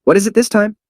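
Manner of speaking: high startled question; high surprised cry. Acted. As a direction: blurt it out, caught off guard. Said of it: "he was surprised".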